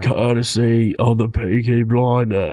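slow put-on British accent; mock British gangster voice; Peaky Blinders. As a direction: draw it out like a British mobster. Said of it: "Are they just British?".

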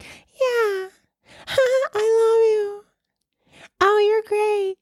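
put-on tiny, very high baby-doll voice; squeaky, sweet imitation of an adoring fan. Audience member gushing at the comedian from the front row, squeaky high.

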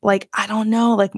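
usual speaking voice

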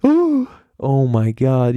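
slow, panicked mock gasp of horror; freaking out. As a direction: drag it out like you've just been caught. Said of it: "you know, like, freaking out".

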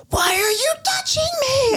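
put-on high, squealing kid rage; raging little brother. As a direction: shriek like an angry kid brother. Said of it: "He'll be like".

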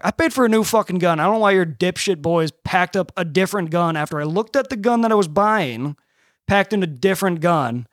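re-enacted tirade at the manager; retold angry rant. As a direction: chew out the manager in the retelling.

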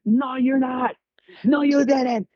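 very high-pitched mock professor yell; imitated yelling. Student imitates professor refusing to listen, high mock yelling. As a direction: shout over the student, imitating an irate professor. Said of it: "he was yelling under that basement".

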